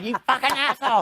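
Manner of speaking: high, loud mock outburst; wife cursing her husband, high and loud mock fury; furious wife. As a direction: spit it out as the betrayed wife.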